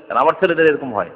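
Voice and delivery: ordinary talk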